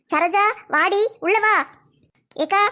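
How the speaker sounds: normal talking voice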